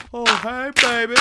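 high put-on flirty voice; mock smooth pickup line. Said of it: "there was this hot chick".

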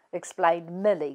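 plain reading voice

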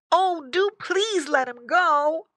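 high, loud, slow plea; squeaky drawn-out entreaty; pleading piglet. Acted. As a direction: beg as a young pig.

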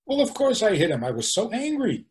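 high-pitched, self-righteous protest; mock indignant excuse. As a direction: play someone excusing their outburst as obvious. Acting out angry person's excuse.

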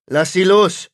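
loud, high order to let go; shouted command. Acted. Owen shouts at the possessed patient to let go. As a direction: bark it over the gun sight. Owen aims gun at demon.

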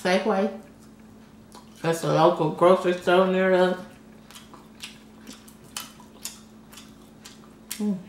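talking while chewing; speaking between bites; mouth full. Real, not acted. Seen 0: bites shrimp at -3, lips pursed chewing through labelled words.

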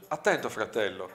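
ordinary delivery

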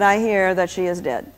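normal talking voice

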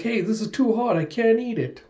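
high-pitched cartoon complaint; hammed-up helpless protest; mock whining. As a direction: whine like a fussy cat. Acted cat complaining food is too hot.

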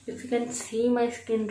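muffled behind palm; speaking while touching face; hand over mouth. Real, not acted. Seen 0: Hand repeatedly covers mouth and nose while showing her skin.

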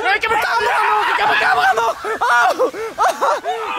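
high, laughing panic; panicked laughing shout. Real, not acted. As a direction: shriek through laughter. Panics about camera near pool.

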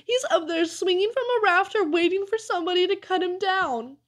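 high-pitched wailing dread; frightened moan. A narrator's reading. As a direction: wail the whole line up high. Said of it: "moaned Amos".

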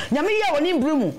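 pitched high, proclaiming that God acts in His time; exclaimed. Real, not acted. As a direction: lift it up like a praise shout. Testimony refrain, proclaimed response.

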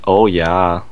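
ordinary talk, nothing marked

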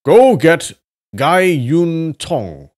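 high, loud and slow command; shouting. Acted. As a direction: shout the order back. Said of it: "Yan Lia shouted back".

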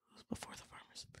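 unvoiced whisper to herself. Real, not acted. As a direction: whisper while trying to recall. Unsure muttering to herself.

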